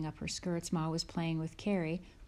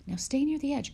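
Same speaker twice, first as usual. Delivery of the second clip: higher, louder mother's warning; Ma's caution; 8 semitones higher, 4 dB louder than usual. Acted. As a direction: call out to the wading girls. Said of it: "Ma told them".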